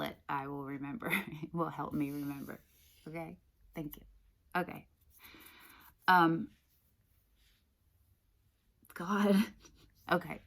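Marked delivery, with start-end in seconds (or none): none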